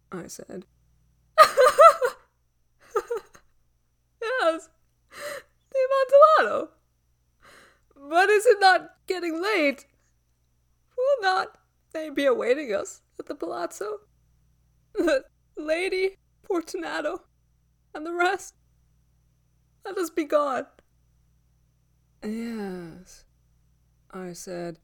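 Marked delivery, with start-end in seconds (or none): 1.4-20.7 high, loud voice breaking into laughter; frantic man pretending it is all a jest; Fortunato's desperate hilarity. A narrator's reading. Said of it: "succeeded by a sad voice". 22.2-23.2 calm echo. A narrator's reading. Calm echo of victim's plea.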